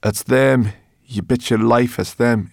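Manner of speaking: emphatic, dreading confirmation; dismayed certainty. A narrator's reading. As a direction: declare the bad news emphatically. Sorrel dreads the elves.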